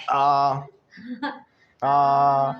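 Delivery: a full open aah drill; open-throated aah. Shown as a demonstration. Teacher demonstrating an open-throat aah. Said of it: "बोलना है तो ऐसे करो".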